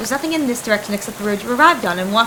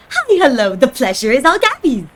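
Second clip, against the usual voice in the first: loud, bouncy goblin greeting; eager goblin Gabby; 4 semitones higher, 6 dB louder than usual. Acted. Goblin Gabby greets the newcomer, eager and loud. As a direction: gush hello like an excited little goblin.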